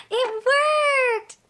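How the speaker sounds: gasp, then high excited exclamation; delighted surprise. Pretend. Teacher showing children the pop worked, excited. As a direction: marvel at it for small children. Magic word reveals the toy.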